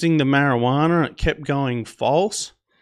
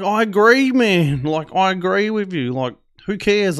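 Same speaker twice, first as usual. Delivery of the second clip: raised, pleading agreement; exasperated agreement; 6 semitones higher, 3 dB louder than usual. Retold or imitated. Traveller agreeing with the scolding officers, exasperated. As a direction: protest that you're on their side.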